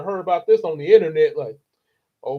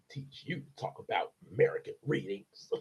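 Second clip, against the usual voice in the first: whispered taunt; 5 semitones lower, 12 dB quieter than usual. Acted. Mock taunt to character.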